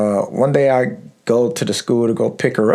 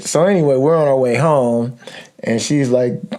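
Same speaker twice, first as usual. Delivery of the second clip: normal talking voice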